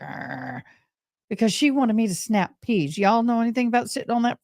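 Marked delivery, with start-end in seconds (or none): 0.0-0.6 low, much softer annoyed growl; a disgruntled grandma grumble; grumbling growl. Retold or imitated. Grandma losing her helper, a low grumbling arr. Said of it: "And Grandma's like".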